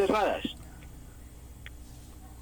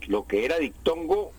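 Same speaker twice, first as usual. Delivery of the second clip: ordinary delivery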